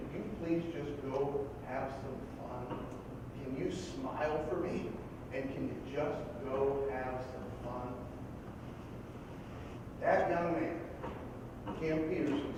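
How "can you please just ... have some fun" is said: slow, much softer and lower, coaxing the kicker to relax; gentle reassurance. Retold or imitated. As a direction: ease the pressure off him, slow and hushed. Coach gently reassuring his kicker.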